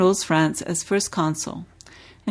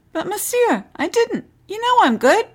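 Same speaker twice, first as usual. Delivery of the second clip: put-on much higher, flustered boy pleading; scolded boy stammering his defense, much higher; 14 semitones higher, 4 dB louder than usual. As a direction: protest in a panicky rush. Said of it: "The boy stammered".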